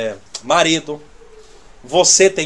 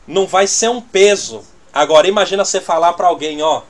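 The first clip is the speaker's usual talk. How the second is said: ordinary delivery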